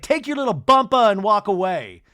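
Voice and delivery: mock loud, high-pitched send-off; retold catty dismissal. Angie dismissing Brittany as she walks away, loud mock scorn. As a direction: throw it out loudly, imitating her.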